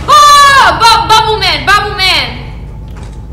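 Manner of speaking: high, loud panicked yelling; startled scream. Real, not acted. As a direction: scream the monster's name in alarm. Startled by game monster.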